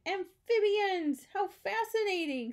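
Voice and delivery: high, loud, drawn-out delight; excited fascination. A narrator's reading. The brain discovers frogs in the bed, fascinated and excited. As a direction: marvel at a thrilling discovery.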